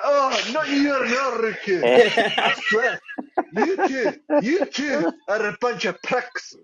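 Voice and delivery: high Scottish scolding with laughter, drawn out at the end; Angie. Retold or imitated. Retelling Angie scolding the two men, high Scottish accent. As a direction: mimic an exasperated Scottish woman. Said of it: "I can't do it Scottish, but she's like".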